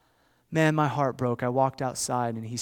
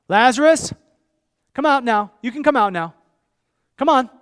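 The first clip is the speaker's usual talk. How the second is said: high, loud summoning call; 11 semitones higher, 11 dB louder than usual. Acted. Jesus calls dead Lazarus out of the grave, loud commanding call. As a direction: call a man out of his grave.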